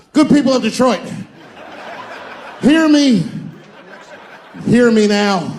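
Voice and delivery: loud, high orator's call; mock grand proclamation. Comedian addressing the Detroit crowd, loud mock-grand proclamation. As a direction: declaim like a preacher to the masses.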